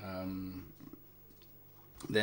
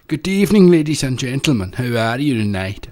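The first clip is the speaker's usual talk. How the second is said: loud, high Scottish island accent, like a compere; 4 semitones higher, 20 dB louder than usual. Shown as a demonstration. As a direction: greet the crowd in a booming island accent. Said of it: "an island accent is very much like".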